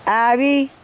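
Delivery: high loud call across the yard. Real, not acted. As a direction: call out to find her. Calling out a name.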